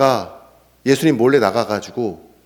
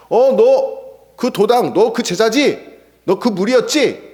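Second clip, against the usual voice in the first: high, slow pointed accusation; a bystander cornering Peter at the fire; accusing servant; 8 semitones higher, 3 dB louder, slower than usual. Acted. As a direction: corner him with a raised, drawn-out accusation.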